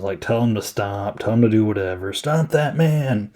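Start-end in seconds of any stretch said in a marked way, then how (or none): 2.1-3.3 drawn-out, higher mock command; barked-order imitation. Loomis ordering cops around, mock commanding call. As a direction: imitate ordering a cop, stretching it out. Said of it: "order cops around".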